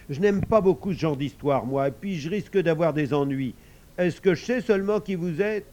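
mildly protesting father voice; reluctant father. Acted. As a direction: grumble objections in character.

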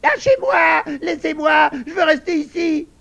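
very high, squealing screams; shrill, frantic little pig yelling; shrieking piglet. A narrator's reading. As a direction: squeal for release at full strength. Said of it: "criait toutes ses forces".

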